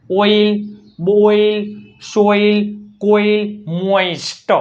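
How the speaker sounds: "ઓઇલ, બોઇલ" each drawn out on a level pitch; demonstrated OI sound. Shown as a demonstration. Teacher showing O before I, "મોઇસ્ટ" stretched word by word. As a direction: sound out "સોઇલ, કોઇલ" with a long OI glide. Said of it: "ઓનો ઉચ્ચાર શું થાય?".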